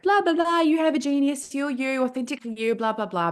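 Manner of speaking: high, mocking sing-song dismissal; mocking blah blah. Mocking her old way of talking about genius, high sing-song. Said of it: "I used to talk about genius like".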